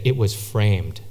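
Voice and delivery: low, soft deadpan punchline; deadpan serious. Retold or imitated. Office friend delivering lame punchline, deadpan. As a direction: drop the punchline with a straight face. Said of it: "in this deadpan, serious voice".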